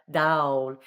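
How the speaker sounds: "dawl" with a drawn, open ah; sounded-out ah. Shown as a demonstration. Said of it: "How I'm sounding out the ah".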